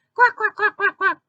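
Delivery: high, rapid cartoon quack-laugh; quacking cackle. Park troublemakers snicker at Supergirl, quacking cackle. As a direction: cackle in a string of squeaky quacks.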